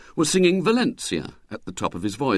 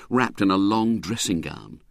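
plain reading voice

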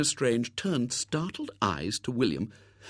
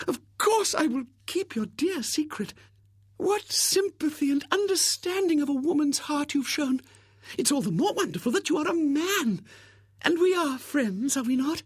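adoring spinster. Acted. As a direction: gush with sentimental admiration. Miss Rubina gushing.

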